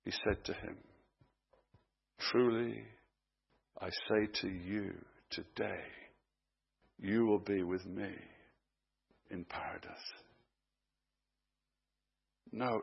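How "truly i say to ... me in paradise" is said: soft, low, unhurried promise; hushed, free of any irritation; gentle reassurance. Acted. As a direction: answer calmly, pausing between phrases. Jesus answers thief without irritability.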